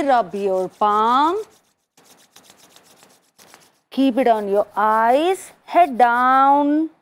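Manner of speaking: very slow, drawn-out guiding voice; guided relaxation. Acted. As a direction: lead them into relaxation, stretching each phrase.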